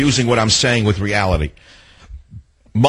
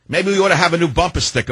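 usual speaking voice

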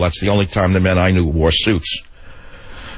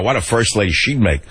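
ordinary talk

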